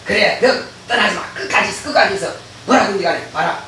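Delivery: sly hushed demon. Retold or imitated. Demon coaxing its servant, hushed.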